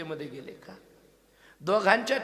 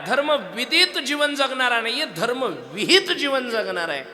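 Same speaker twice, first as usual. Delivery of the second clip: much higher, much louder sermon proclamation; full-force preaching; 7 semitones higher, 13 dB louder, slower than usual. Acted. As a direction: proclaim it to the crowd. (कीर्तनकाराचा जोशपूर्ण, मोठ्या आवाजातील उपदेश)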